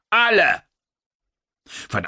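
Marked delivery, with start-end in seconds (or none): 0.1-0.6 high, loud single-word command; barked order. Acted. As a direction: snap out the last word as an order. Commander barks emphatic order.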